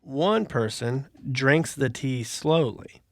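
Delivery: slow, deliberate riddle read. Acted. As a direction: read it out slowly for suspense. Reads the riddle aloud slowly.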